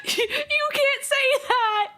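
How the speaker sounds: high, slow, squealing mock-shocked protest; mock outrage. Streamer pretends to be shocked at a chat joke, squealing. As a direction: squeal it in fake scandalized disbelief.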